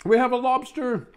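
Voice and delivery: high, loud mock alarm; excited alarm. Real, not acted. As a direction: sound the alarm, all excited. Lobster falls out of sandwich.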